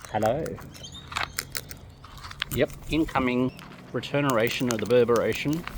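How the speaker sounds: normal talking voice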